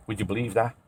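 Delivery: ordinary delivery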